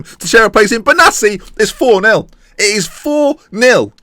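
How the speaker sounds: high, loud goal shout building to the score; excited goal call. Real, not acted. Commentator to viewers, roaring the fourth goal. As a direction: scream the goal home like a commentator.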